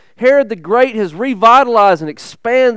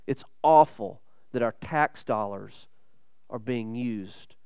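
much lower and softer, heavy; a preacher lamenting in a hushed voice; low grave lament; 11 semitones lower, 13 dB quieter than usual. Acted. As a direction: mourn it under your breath.

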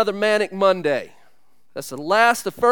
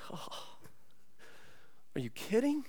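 stunned hush; 3 semitones higher, 18 dB quieter than usual. Retold or imitated. Stunned reaction to flood news.

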